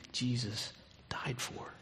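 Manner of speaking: hushed whisper of awe. Real, not acted. Pastor to congregation about the cross, a hushed whisper. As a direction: breathe it out barely voiced.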